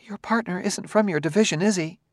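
high-pitched wife, mildly shaky. A narrator's reading. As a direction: ask it with a touch of worry, pitched high.

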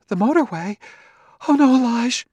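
high-pitched startled protest; alarmed. A narrator's reading. Wife dreading the motorway, protesting to husband in alarm.

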